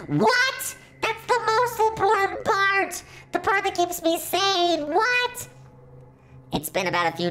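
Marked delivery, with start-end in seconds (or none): 0.1-5.5 panicked robot. Acted. Robot Fandroid shrieking at losing his sanity, frantic.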